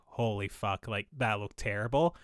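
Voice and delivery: low, soft director's aside; muted dismay. Retold or imitated. Director after Shatner's one take, low muted dismay. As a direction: drop low and quiet, appalled under it.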